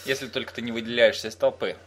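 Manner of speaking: normal talking voice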